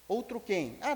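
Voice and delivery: normal talking voice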